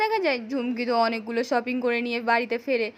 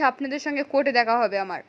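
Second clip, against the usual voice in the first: plain reading voice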